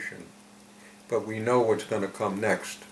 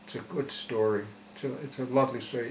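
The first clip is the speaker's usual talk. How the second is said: usual speaking voice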